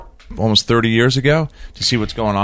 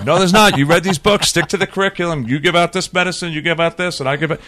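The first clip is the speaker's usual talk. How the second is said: raised-pitch mock insistence; dismissive authority; 8 semitones higher than usual. Medical establishment to a questioner, mock dismissive. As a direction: shut the objection down, imitating rigid authority. Acted argument, establishment shuts down objection.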